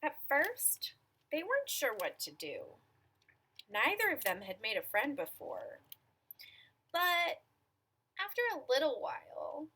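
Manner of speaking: measured storytelling with stretched key words; picture-book narration. A narrator's reading. Reader telling children about an imaginary friend, storytelling voice. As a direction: read it to small children, pausing between phrases.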